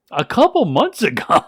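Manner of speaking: loud, high-pitched mock disbelief; acted-out stunned outburst; incredulous echo. Journalist echoes in disbelief.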